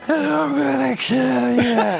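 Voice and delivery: high, slow, strained mock threat; too tired to scream. Shown as a demonstration. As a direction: imitate a sleeper trying and failing to scream. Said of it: "I'm sleeping and I'm too tired to scream".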